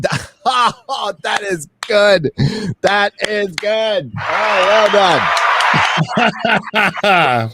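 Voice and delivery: high, slow laughing approval; laughing praise. Real, not acted. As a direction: crack up and applaud the joke. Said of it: "That's exact".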